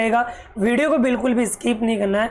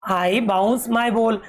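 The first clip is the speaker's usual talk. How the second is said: even slower, steady word by word; a slower second reading; slow repeat; much slower than usual. Shown as a demonstration. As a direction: repeat it slowly so kids follow. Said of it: "teacher repeating line slowly".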